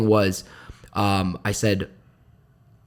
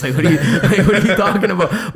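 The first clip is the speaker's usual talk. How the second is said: higher, louder protest through laughter; laughing mock protest; 6 semitones higher, 5 dB louder than usual. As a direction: laugh incredulously while imitating your own complaint. Laughing protest to his mother, retold.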